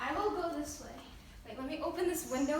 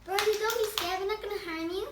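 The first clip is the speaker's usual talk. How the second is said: coaxing a wild bird; 4 semitones higher, 7 dB louder, faster than usual. Real, not acted.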